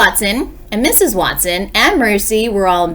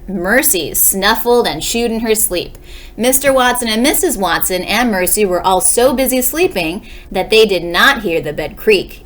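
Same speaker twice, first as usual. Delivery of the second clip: ordinary delivery, nothing marked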